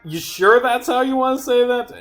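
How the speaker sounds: high-pitched disbelieving needling; high mock doubt; incredulous challenge. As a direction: question their wording in a high, incredulous voice. Mocking challenge to a misread line.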